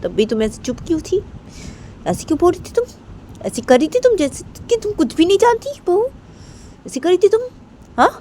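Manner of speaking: high-pitched, swooping accusatory questions; angry scolding. Acted. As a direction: grill the daughter-in-law indignantly. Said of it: "ऐसे गुस्से में बोलती है रायमा को". (गुस्से में ऊँची आवाज़ से डाँट)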